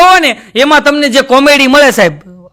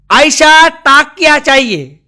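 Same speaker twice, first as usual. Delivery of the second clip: put-on slow, drawn-out Nepali-accented request; 4 semitones higher, much slower than usual. Nepali customer at the bedding shop, slow Nepali accent. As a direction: ask for a pillow in a thick Nepali accent. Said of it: "એક નેપાળી છે ને".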